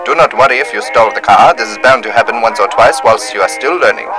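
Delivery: ordinary delivery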